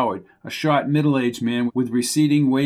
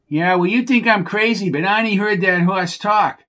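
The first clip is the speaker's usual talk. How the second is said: higher, whiny voice of a dim stable hand; exasperated Bucky; 7 semitones higher than usual. Acted. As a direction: scold the horse like a fed-up oaf. Bucky insists.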